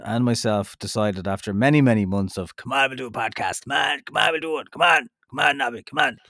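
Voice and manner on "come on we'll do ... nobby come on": lightly voiced nagging friend; a hint of pleading, come on; impression of Bob. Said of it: "that's my impersonation of you".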